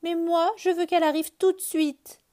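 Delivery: put-on high, loud childish lament. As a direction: wail it like a rabbit who can't wait. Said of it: "se lamente Lapin".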